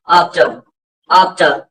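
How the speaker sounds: आफ्टर ending in an open "tuh"; short, falling आफ्टर with no R; silent R. Shown as a demonstration. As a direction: drop the R from आफ्टर. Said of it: "आपको सिर्फ इतना ही रखना".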